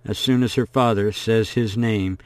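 usual speaking voice

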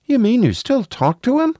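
put-on high, wondering little kid; high-pitched, surprised child voice. A narrator's reading. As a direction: ask like an astonished young child.

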